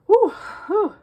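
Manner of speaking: two high, loud exhaled whews; winded whew. Real, not acted. Catching breath after talking so long, winded whews. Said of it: "I am out of breath".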